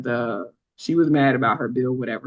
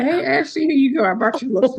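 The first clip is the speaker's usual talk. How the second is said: high, sing-song offer; mock-sweet teasing; 8 semitones higher, 3 dB louder than usual. Teller handing lotion to ashy classmate, high mock-sweet. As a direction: tease him with fake kindness.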